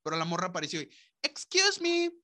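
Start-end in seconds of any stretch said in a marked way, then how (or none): 1.2-2.1 much higher and louder, a pushy suburban lady. Acted. Suburban woman stops a man walking his dog, high, loud and nosy.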